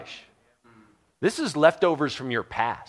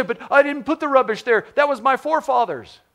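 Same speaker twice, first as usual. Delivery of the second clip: high, loud whining complaint; mock a whiner dodging blame; whiny victim; 11 semitones higher, 5 dB louder than usual. Preacher mocking a congregant who blames forefathers, whining.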